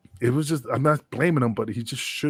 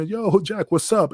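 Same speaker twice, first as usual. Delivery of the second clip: cheery greeting; 8 semitones higher, 3 dB louder, slower than usual. Retold or imitated. Friendly greeting to Jack.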